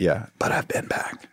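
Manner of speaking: whispered confession, voice dropped to breath; secret whisper. Real, not acted. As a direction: whisper it like a secret you shouldn't tell. Confessing secret, don't tell them.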